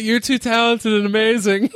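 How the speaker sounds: mock gushing fan, high-pitched; starstruck adolescent. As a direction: play a giddy teenage admirer. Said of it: "revert to, like, adolescent Joe".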